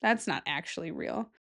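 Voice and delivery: usual speaking voice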